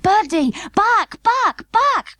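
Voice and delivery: very high, mock panicked cry; frantic doll father. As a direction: shout warning in a tiny voice. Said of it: "cried Mister Plantagenet".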